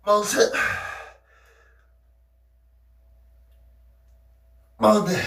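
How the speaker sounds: high, loud, straining rep count; doing push ups. Real, not acted. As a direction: push out each number through effort. Final push-ups, exhausted and straining. (팔굽혀펴기 하며 힘겹게 외치는 숫자)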